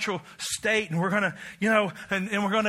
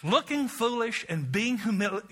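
normal talking voice

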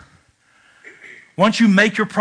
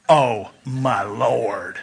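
awed sigh; 9 semitones lower, 5 dB quieter, much slower than usual. Retold or imitated. Drooling over new Harleys.

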